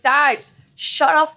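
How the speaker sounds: high, slow drawn-out whine; mock exasperated snap. Telling dad off over Botched, high slow whine.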